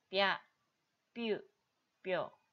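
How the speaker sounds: 뼈 and 뷰 each as one quick syllable, pya, pyu, pyo; brisk one-beat 뼈, 뷰, 뼈; quick blended syllables. Shown as a demonstration. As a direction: say 뼈, 뷰, 뼈 as short single beats. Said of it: "빨리".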